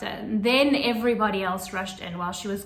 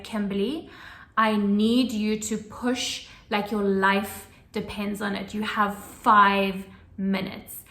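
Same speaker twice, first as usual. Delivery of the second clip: slow, grave and deliberate; dead serious; slower than usual. Retold or imitated. Doctor telling the exhausted mother to push, slow and dead serious. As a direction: make every word count. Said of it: "like, dead serious".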